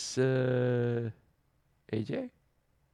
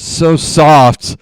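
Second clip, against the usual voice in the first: sleepy mumble; 3 semitones higher, 15 dB louder than usual. Retold or imitated. Girlfriend talking in her sleep, a drowsy mumble. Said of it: "mumbles something and then says".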